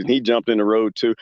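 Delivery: ordinary talk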